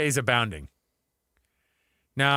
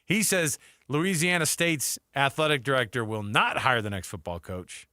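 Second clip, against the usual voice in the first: normal talking voice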